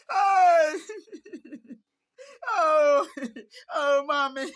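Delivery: loud, high mock wail for a dead mother; wailing cry. Mourner wailing at a funeral, loud high hammed-up cry. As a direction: wail out over a lost mother, half laughing.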